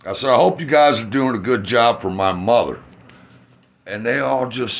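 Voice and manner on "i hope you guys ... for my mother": higher, louder puffed-up warning; playing the big tough guy to the workers; mock-macho warning voice. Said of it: "big tough guy, I'm gonna intimidate these guys".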